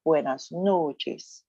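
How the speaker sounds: very slow, careful buenas noches; slow model pronunciation. Shown as a demonstration. Teacher reviewing greetings for the class, slow model pronunciation. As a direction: stretch it out for learners. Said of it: "Remember how you say".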